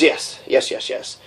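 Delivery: mama's approval, fading to a whisper; whispered yes. Retold or imitated. Mama's approval of beating boys, whispered. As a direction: breathe the yeses out, barely voiced.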